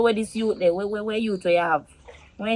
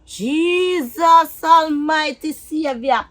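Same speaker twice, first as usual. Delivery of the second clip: slow, drawn-out cry to heaven; appalled exclamation; 3 semitones higher, much slower than usual. Acted. Partner learning the son knows, appalled and drawn out. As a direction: stretch each word out in horror.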